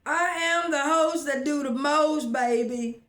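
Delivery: a loud, high sassy brag to viewers; sassy boast. Real, not acted. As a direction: show off like the star of the show. Proud catchphrase boast.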